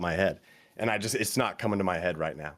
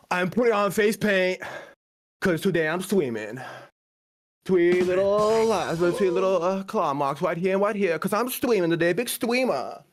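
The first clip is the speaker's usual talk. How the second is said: mock wife voice; 9 semitones higher than usual. Roaster mocking Pwn's wife in the bathroom, high silly voice.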